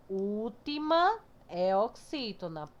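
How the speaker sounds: ordinary delivery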